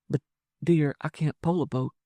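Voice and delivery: high, dainty woman's voice; doubtful woman. Acted. Wife doubting she can pole a boat, high and coy.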